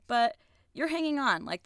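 normal talking voice

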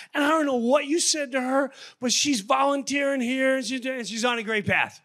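high, tearful pleading voice; tearful mother. Retold or imitated. The coffee shop mother tells him of her daughter, tearful and high. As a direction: plead through tears as a grateful mother. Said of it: "she started crying".

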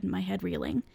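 plain reading voice

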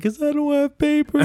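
high, laughing imitation of a crying kid; little brother crying. Imitating little brother's tearful answer, laughing. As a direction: squeak it out like a scared five-year-old. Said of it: "And he's like".

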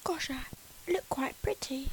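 Keira Knightley impression. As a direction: talk through your teeth in a whisper. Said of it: "Got to talk with your teeth".

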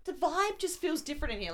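high, innocent mock question; Amy Schumer impression. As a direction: play dumb, sweetly asking what happened. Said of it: "she's like".

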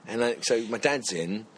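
ordinary talk

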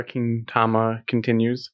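usual speaking voice

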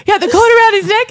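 high, loud, fast and urgent; blurting the news in a rush; excited husband. Retold or imitated. Imitating her husband telling her about the cord, high and loud. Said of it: "and Mace is like".

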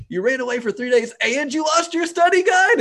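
high, loud incredulous scolding; disbelieving mom telling him off; scolding parent. Retold or imitated.

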